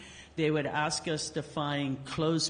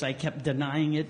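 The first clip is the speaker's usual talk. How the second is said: usual speaking voice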